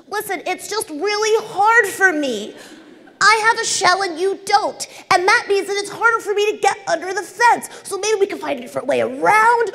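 high, put-on snail voice. Snail pleading with caterpillar to get under fence, high put-on voice. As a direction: pitch it way up as the gentle snail. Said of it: "I don't know why she talks like that".

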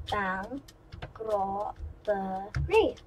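"Bank robbery" slowly pulled apart, syllable by syllable; broken up. Shown as a demonstration. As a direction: break "Bank robbery" into separate, drawn-out pieces. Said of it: "if I break it up".

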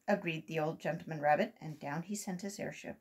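plain reading voice

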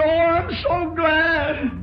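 slow, lifted cry of relief; retold gladness. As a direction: stretch it out with heartfelt gladness. Retells his joy at the news.

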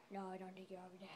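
very soft, low aside; hushed aside. Pretend. Answering own shouted call, hushed and low. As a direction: answer yourself under your breath.

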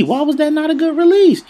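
squeaky complaint. Real, not acted. Gamer protesting a missed jumper to viewers, squeaky whine. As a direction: whine it in a high squeak at the game.

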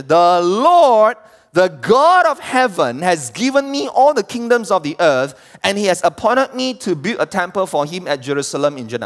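high, forceful verse reading; scripture proclamation. Acted. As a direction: proclaim the king's decree from the pulpit.

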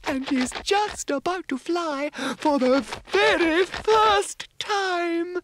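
very high, gentle coo; cooing fairy. Acted. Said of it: "cooed Mavis".

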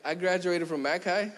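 sheepish and laughing. Retold or imitated. Preacher mocking his own modest diploma, laughing. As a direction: laugh through the modest answer. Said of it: "you're like".

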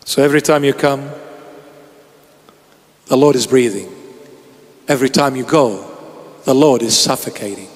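hushed tender plea. Acted. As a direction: draw them in gently. Quiet closing of sermon.

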